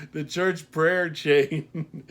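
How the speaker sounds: real laugh in the voice, loud and slow. Real, not acted. Reader retakes a stumbled line, laughing.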